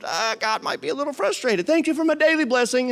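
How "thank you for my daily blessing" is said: a lazy thank-you in a put-on high voice; whiny praying believer. Pastor mocking a believer's daily prayer, high and put-on. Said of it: "I'm looking at this going".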